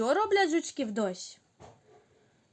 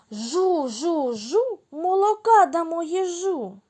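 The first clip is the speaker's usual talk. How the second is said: higher, amazed tone; surprised; 6 semitones higher, 4 dB louder than usual. Shown as a demonstration. Teacher repeats the surprised chant. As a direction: say it astonished. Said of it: "А тепер здивовано".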